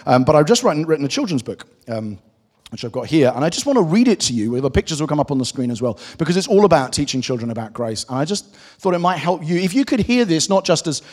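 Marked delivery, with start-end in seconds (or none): none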